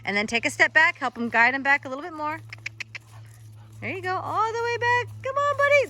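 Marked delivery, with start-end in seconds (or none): 3.8-5.9 much higher, coaxing call to the dog; coaxing the dog into position, high. Real, not acted. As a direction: lure him back with a high voice. Coaxing dog back into heel.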